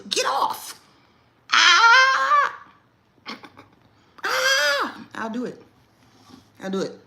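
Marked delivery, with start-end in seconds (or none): 0.1-5.0 high loud yelps; dramatic yelp. Shown as a demonstration. Mom dramatically yelping at her big son. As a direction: scream it out. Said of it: "I'm super dramatic".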